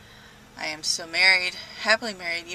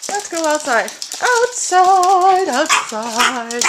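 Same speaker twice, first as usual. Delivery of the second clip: sing-song cat talk; 8 semitones higher, 14 dB louder, much slower than usual. Real, not acted. Calling the cat outside.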